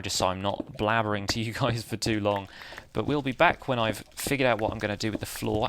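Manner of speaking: ordinary talk, nothing marked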